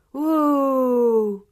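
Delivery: loud, high, long-held ooh; ecstatic wonder. A narrator's reading. Children admiring the trophy, ecstatic wonder. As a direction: swoon loudly in admiration. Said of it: "s'extasient les enfants".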